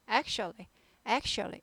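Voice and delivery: ACTUALLY as "ak-shly", middle swallowed; reduced pronunciation. Shown as a demonstration. Teacher repeats for learners, ACTUALLY shortened. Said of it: "이렇게 발음합니다".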